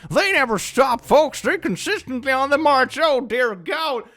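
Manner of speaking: loud, high-pitched hammed-up warning cry; over-the-top mock alarm, pitched high; mock alarmist announcer. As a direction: holler it like a panicked doomsday broadcaster. Mock alarmist broadcast about femboy farmers.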